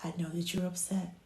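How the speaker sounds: very soft, soothing murmur; hushed comfort for an upset baby; near-whispered reassurance with pauses; gentle. Shown as a demonstration. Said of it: "just kinda gently say".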